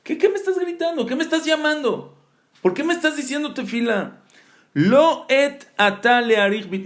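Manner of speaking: high-pitched exasperated questions; acted indignant rebuke. Hashem questions Moshe for praying now, mock exasperated rebuke. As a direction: imitate an incredulous scolding, pitched well up.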